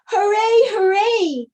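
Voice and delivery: high, loud hooray; cheering. A narrator's reading. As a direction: shout for joy. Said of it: "cried the funny little clown".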